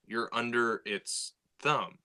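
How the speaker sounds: ordinary talk, nothing marked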